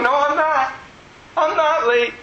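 high-pitched, giddy insistence; excited. Retold or imitated. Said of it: "I was just excited".

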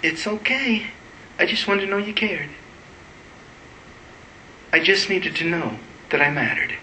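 put-on small, soft, high child's voice; three-year-old kid. Hurt child answering God after weeping, small three-year-old voice. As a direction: softly voice a hurt little child. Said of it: "I sounded like a three-year-old kid".

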